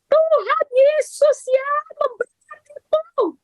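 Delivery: very high, slow, strained yell; mock shrieked blessing. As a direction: shriek the blessing through gritted annoyance. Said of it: "teriak ya seperti Bu Cincin". (teriakan jengkel yang dibuat-buat)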